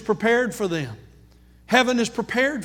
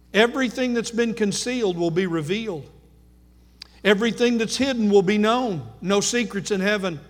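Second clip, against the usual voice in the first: normal talking voice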